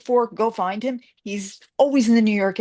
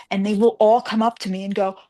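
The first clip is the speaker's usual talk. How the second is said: ordinary talk, nothing marked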